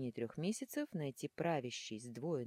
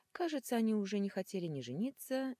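ordinary delivery

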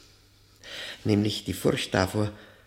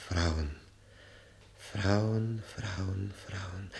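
fading obsession; 2 semitones lower, 6 dB quieter, much slower than usual. Acted. Haunted repetition of a word.

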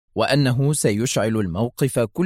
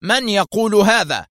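angry shout; 9 semitones higher, 4 dB louder than usual. Acted. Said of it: "هاتفا".

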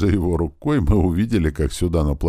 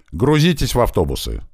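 raised, higher commanding voice; shouted order; 7 semitones higher, 4 dB louder than usual. A narrator's reading. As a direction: call out the order to everyone. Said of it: "заорал Грач". (командный окрик повышенным голосом)

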